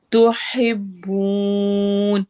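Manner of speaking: slow, drawn-out tajweed chant; Quran recitation. Shown as a demonstration. Teacher recites verse for students, slow tajweed chant. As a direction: recite it slowly, stretching each sound. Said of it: "बू वाओ मद्दा है".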